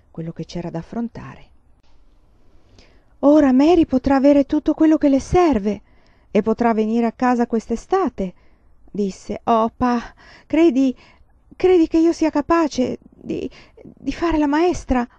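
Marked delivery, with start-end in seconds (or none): 3.2-8.3 excited. Acted. Laura thrilled at her certificate. 9.4-15.1 high, slow, eager and unsure; anxious excitement. Acted. Laura asks Pa if she can teach, high and anxious.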